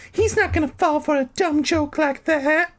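squeaky high character voice; Carolyn voice. A narrator's reading. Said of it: "she told him".

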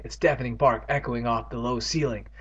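usual speaking voice

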